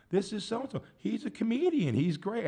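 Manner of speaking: high, gushing mock voice; imitated eager introducer. Fan informing others about a comedian, in high mock eagerness. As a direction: talk him up brightly in a high pitch.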